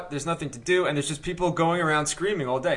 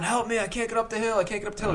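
high, pleading mock yell without the volume; held-back yell, high and insistent; retold yelling; 6 semitones higher, 5 dB quieter than usual. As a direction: imitate a stranded man calling out, pitched up. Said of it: "So he's yelling".

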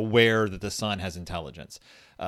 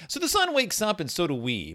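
put-on reading to a toddler; 7 semitones higher than usual. Reads baby book aloud.

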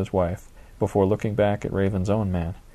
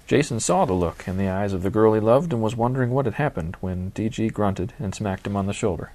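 ordinary delivery

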